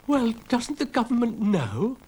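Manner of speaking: ordinary delivery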